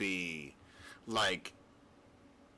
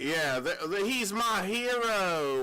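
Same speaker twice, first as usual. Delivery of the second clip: mock fan voice; 6 semitones higher than usual. Mocking Charlie Kirk fans.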